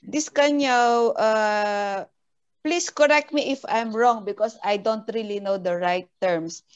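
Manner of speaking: ordinary talk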